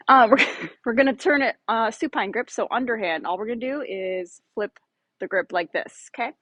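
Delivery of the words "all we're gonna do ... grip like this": ordinary delivery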